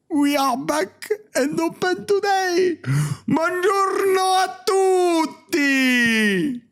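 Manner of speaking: high, slow hammed-up Italian English; hammed-up Italian accent, called out; mock Italian chef. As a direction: belt the greeting like a cartoon Italian cook. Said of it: "écoutez-moi cet accent".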